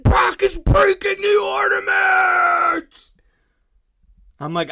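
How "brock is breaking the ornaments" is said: put-on high, loud, slow wail of a tattling child; kid's outraged shout. Son yelling that his brother breaks ornaments, high outraged shout. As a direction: shriek it like an upset little boy. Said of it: "He's like".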